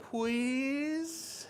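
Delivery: high, drawn-out begging; pleading baby. Acted. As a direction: melt his heart with a rising plea. Baby Raven begs grandfather.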